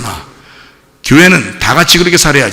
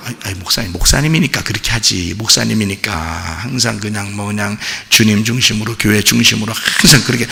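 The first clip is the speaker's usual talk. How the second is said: grumbling congregant; 5 semitones lower, 6 dB quieter than usual. Retold or imitated. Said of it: "이게 여러분 생각 어떻게 하냐면".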